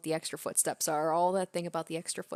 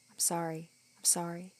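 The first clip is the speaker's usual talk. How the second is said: much softer, hushed repeated sorry; a faint ghostly apology; 6 dB quieter than usual. Acted. Oyashiro-sama apologizing endlessly.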